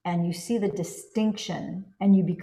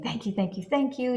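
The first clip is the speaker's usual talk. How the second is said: grateful thanks; 6 semitones higher than usual. Pretend. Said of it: "you see yourself saying".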